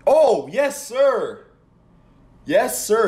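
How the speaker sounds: high, loud cheering yell; excited yell. Real, not acted. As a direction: whoop it out in triumph. Interception for his team.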